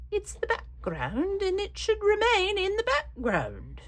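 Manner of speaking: very high, slow, terrible put-on accent; Diane Reevely. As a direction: do a bad high-pitched accent impression, drawn out. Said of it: "that's a terrible accent".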